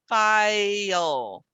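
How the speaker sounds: file slow, the I sliding through a y to the L; file with a glide. Shown as a demonstration. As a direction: let the tongue slide forward. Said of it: "identify as the Y".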